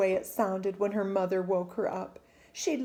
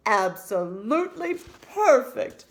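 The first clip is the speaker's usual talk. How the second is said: louder, hearty doting father; 2 semitones higher, 7 dB louder than usual. Acted. Father lists praises of his daughter's name, doting and loud. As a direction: list each word like a treasure.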